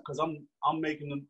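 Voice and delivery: normal talking voice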